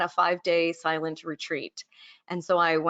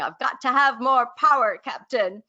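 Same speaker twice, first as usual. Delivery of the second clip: lightly imitated Scottish accent, higher and louder; 5 semitones higher, 4 dB louder than usual. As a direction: have a rough go at a Scottish engineer's accent. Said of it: "in his wonderful Scottish accent".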